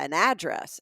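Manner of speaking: "address" as AD-dress; Southern front stress on "address"; first-syllable stress. Retold or imitated. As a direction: punch AD in "address". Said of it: "emphasis on the first syllable".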